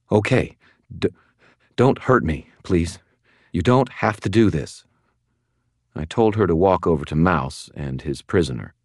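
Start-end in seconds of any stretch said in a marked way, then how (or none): 0.1-4.8 high, halting, frightened plea; scared woman's voice, pitched up; trembling. Acted. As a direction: stammer and plead for your life. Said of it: "visibly trembling".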